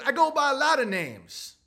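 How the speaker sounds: lightly voiced evasive street guy; evasive suspect. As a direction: slip past the cop's question coyly. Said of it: "It's like".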